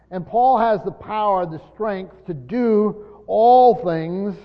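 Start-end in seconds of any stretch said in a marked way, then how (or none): 2.3-4.3 "all" drawn out long and loud; stress on "all". Real, not acted. Preacher stressing the verse to the congregation, emphatic "all". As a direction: stretch "all" slowly with extra force. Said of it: "all is very emphatic".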